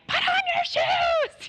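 high, loud, slow mock yell; yelling at kids. Mom yells at her kids to put shoes on, loud and high. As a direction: belt it at the kids like a fed-up mom. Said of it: "with me, I'm like".

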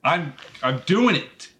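mildly yelled protest; yelling. Real, not acted. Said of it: "why you're yelling at me".

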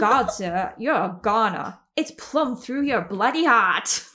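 put-on half-sure stab at an old British accent; an amateur's try at a period British accent; attempted British accent. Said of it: "I'm not quite sure what kind of accent this is, but I'm gonna do my best".

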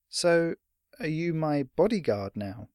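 lightly voiced boy asking hopefully; questioning boy. Boy questions the old fighter.

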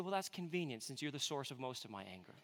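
low, quiet sarcastic comeback; dry retort. Acted. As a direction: toss it off under your breath, deadpan. Thought retort to his son, joking.

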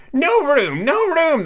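high cried-out protest; crying out. A narrator's reading. Tea party guests crying out at Alice, high and loud. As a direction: call it out shrilly high, as the crowded tea party. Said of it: "they cried out".

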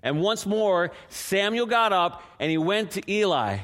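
normal talking voice

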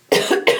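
single hacking cough; a sharp cough, then clear the throat; chesty cough. Real, not acted. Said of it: "Excuse me".

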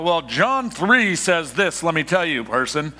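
slow, lightly voiced preachy know-it-all; mock preachy Bible quoter. Pastor mocking someone who lectures friends with scripture. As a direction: poke fun at a lecturing believer. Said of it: "not talking about you breaking out the Bible".

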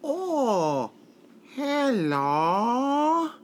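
high, slow goofy Sandler voice; Adam Sandler impression. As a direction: do a dopey high-pitched Sandler. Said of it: "give me an Adam Sandler impression".

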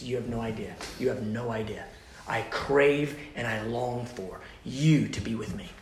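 hushed, low, tender voice; intimate. Acted. God quietly telling the believer he longs for him. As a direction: murmur it close and lovingly.

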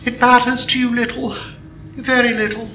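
put-on high, tearful young girl; through tears. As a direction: speak through tears, hurt. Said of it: "spoke through her tears".

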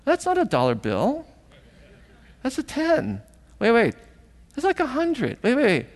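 put-on high, incredulous kid doing a double take; dawning disbelief. Kids reading the bills on the money lay, in disbelief.

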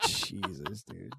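very soft, low aside; stunned mutter. Retold or imitated. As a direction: mutter it under your breath. Own stunned muttered reaction.

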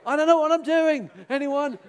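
high, loud mock helpless cry; mock panic. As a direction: call out for help in hammed-up panic. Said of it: "I thought".

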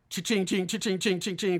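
imitated cha-ching sound effect; rapid-fire repeated chings, evenly chanted; cash register chime. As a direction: mimic coins ringing up on a computer. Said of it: "this sound effect of".

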